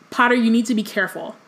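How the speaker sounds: usual speaking voice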